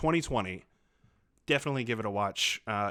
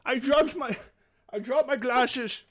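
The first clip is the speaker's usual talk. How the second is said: silly high voice; 13 semitones higher, 7 dB louder than usual. Acted. Bursts in with silly greeting.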